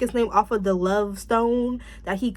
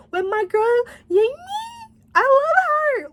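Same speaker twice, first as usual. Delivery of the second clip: much higher, squealing over her favourite; excited squeal; 4 dB louder, slower than usual. Real, not acted. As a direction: squeal with fan delight, then louder. Gushing over favourite actress.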